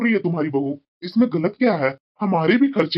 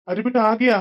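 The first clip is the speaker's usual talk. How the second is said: normal talking voice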